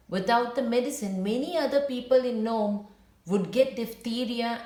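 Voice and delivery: clear, gentle storybook reading; reading to children. A narrator's reading. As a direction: read the story aloud to young listeners. Teacher reads story to class.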